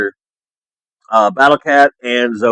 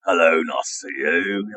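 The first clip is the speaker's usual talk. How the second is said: lower, softer English accent with added inflection; exaggerated English accent; 8 semitones lower, 7 dB quieter than usual. Shown as a demonstration. Streamer showing his English accent falling apart with more inflection, exaggerated English accent. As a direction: push the English accent off the rails, low and drawn. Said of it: "another, like, inflection to it".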